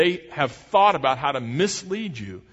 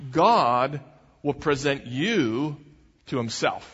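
ordinary delivery

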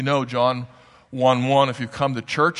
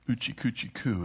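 mock baby talk; 9 dB quieter than usual. Preacher illustrating being coddled as an infant, hushed mock baby talk. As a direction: lightly imitate cooing at a baby, hushed.

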